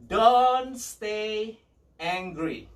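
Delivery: DON'T loud and high, a pause before "angry"; emphatic repeat. Real, not acted. Preacher repeating the important point to his congregation, emphatic. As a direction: punch "Don't stay", hold it, then drop on "angry".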